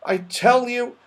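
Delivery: cross, slow and high; quite cross. Acted. Joe telling the saucepan man there's no cat, cross. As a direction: insist crossly, stretching the words. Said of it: "feeling quite cross".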